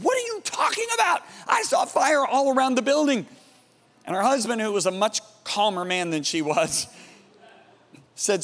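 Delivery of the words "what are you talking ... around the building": very high-pitched mock outrage; high, incredulous retold outburst; mad, indignant retort. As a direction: imitate her exasperated protest, pitch leaping up. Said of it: "she got so mad at me".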